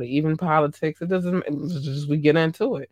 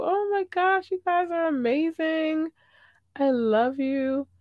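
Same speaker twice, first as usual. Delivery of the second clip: gushing; 12 semitones higher than usual. Real, not acted. Host gushing over viewers.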